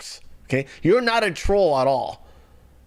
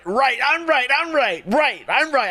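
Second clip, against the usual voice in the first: higher, smug repeating of right; mocking Tim Pool; 8 semitones higher than usual. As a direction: parody a man who's never wrong. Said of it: "He's always right".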